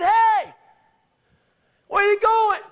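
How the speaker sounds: high, loud shout after someone leaving; calling out. Retold or imitated. Preacher calls after the healed woman as she leaves, high incredulous shout. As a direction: yell it to stop them leaving.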